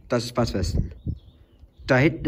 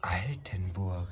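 whispered; 7 semitones lower, 11 dB quieter, slower than usual. Real, not acted. Whispered place name.

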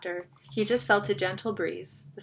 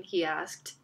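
plain reading voice

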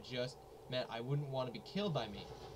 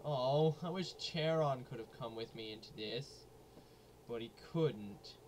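ordinary talk

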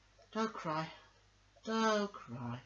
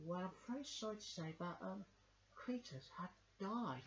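much softer, hushed delivery; somber bad news; 3 semitones higher, 11 dB quieter than usual. [name] telling Dog his best friend died, softly. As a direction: break the news gently.

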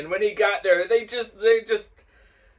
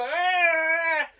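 long, high, loud mock cry; drunken wail; 7 dB louder than usual. Storyteller mimics the drunk man crossing traffic, loud wail.

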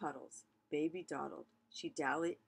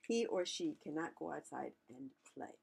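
usual speaking voice

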